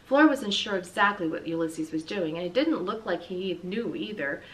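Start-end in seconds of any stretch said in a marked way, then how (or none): none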